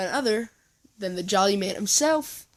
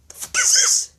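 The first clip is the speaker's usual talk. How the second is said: shocked outburst; 2 semitones higher, 8 dB louder, faster than usual. Acted. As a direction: blurt it out in a shriek. Ryan shocked by family secret.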